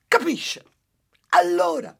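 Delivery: very high, loud demanding outburst; exasperated housewife. Acted. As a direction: demand an answer from the sage. Housewife ends her complaint. (massaia esasperata, molto acuta e forte)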